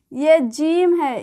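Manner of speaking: slow, careful "ये जीम है" with a long ee in जीम; letter name demo. Shown as a demonstration. Teacher reading a letter name to children, slowly. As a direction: model "जीम" slowly for beginners. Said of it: "ये जीम पढ़ते हैं".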